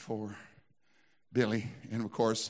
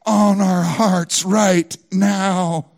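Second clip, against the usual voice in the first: loud, slow, ringing sermon delivery; full-force preaching; 6 semitones higher, 16 dB louder, much slower than usual. Acted. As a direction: preach it out to the whole congregation.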